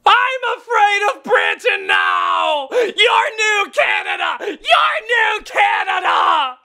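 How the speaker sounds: slow hammed-up yell at Britain; mock outraged yell. Real, not acted. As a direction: rant it slowly at the screen. Ranting at Britain's scary PSA.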